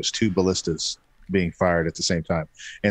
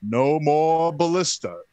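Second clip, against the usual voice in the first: mock decree; 6 semitones higher, slower than usual. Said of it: "he goes".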